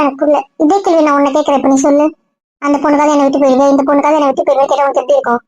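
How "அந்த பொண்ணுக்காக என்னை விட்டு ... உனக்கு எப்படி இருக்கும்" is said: rapid heated questioning. Acted. Friend lectures him angrily.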